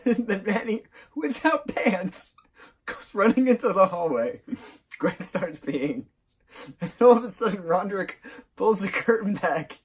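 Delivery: high voice breaking up with laughter; real, helpless laughter under the words; laughing while retelling. Real, not acted. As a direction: struggle to tell it through giggles. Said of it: "I cannot retell the scene without hurting myself of laughter".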